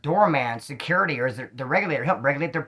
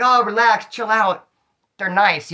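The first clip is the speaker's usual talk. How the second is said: high, loud carefree reassurance; breezy dismissal; 5 semitones higher, 5 dB louder, slower than usual. Retold or imitated. The dog owner waving off his fear, high and breezy. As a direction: brush off his fear like it's nothing.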